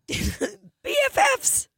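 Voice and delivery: very high squeal; squeaky cartoon reply. Mister Hanky answering Tony, very high squeak. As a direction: chirp it in a tiny cartoon voice.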